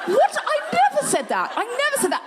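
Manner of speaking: very high, angry squeal of disbelief; high-pitched outrage. Retold or imitated. Comedian re-enacting her denial on air, high-pitched outrage. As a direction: protest in an indignant, squeaky-high voice. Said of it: "I got a bit high-pitched".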